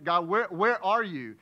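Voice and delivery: loud pleading cry to God; mock desperate prayer. Preacher acting the listener's desperate prayer, pleading loud. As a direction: plead like you feel abandoned. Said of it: "You prayed that prayer before".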